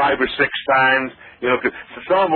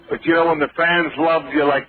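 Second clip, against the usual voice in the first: ordinary talk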